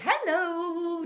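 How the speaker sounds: the wrong bright greeting for a gruff caller; too chirpy hello. Shown as a demonstration. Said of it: "You aren't going to say".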